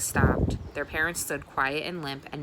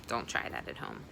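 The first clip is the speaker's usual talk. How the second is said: whispered aside; 7 dB quieter than usual. Real, not acted. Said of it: "aside warning to listeners".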